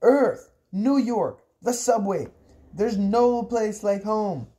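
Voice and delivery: high, forceful calls to be taken away; high-pitched shouting, easing in volume; desperate scream. Acted. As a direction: call out each destination high, first one loudest.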